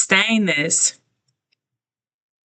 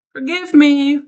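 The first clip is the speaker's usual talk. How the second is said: higher, louder through soup; mouth full, apologetic; 10 semitones higher, 3 dB louder than usual. Real, not acted. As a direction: apologize while chewing. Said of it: "I'm eating my soup".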